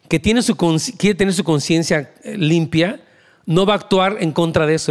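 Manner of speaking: usual speaking voice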